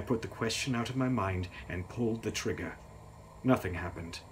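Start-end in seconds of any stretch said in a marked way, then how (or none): none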